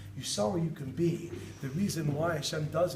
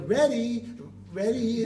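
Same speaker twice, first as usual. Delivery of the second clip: lightly voiced eager child, higher; kid voice; 6 semitones higher, much slower than usual. Child answering dad, lightly voiced eager ready. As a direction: chirp it back like a small kid.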